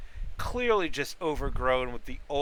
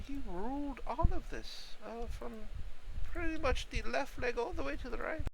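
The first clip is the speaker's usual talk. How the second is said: put-on old mushroom voice; 8 semitones higher than usual. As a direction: elderly voice. Elderly mushroom NPC rambles.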